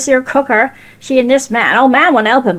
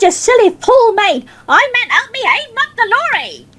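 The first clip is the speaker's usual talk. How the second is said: exasperated scolding; 11 semitones higher than usual. Retold or imitated.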